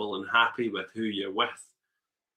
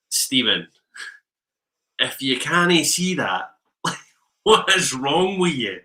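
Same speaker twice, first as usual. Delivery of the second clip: louder, slow and incredulous; mock exasperated scolding; 4 semitones higher, 7 dB louder, slower than usual. Shop friends teasing Stephen, mock exasperated. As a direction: scold him for being blind to it.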